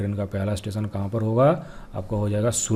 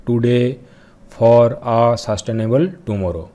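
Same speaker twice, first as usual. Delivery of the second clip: very slow and louder, each word drawn out; slow repeated dictation; 4 dB louder, much slower than usual. Shown as a demonstration. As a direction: repeat it slowly for students to note down. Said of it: "देखिए मैं फिर से बोल रहा हूँ". (बहुत धीरे, ज़ोर से दोहराते हुए)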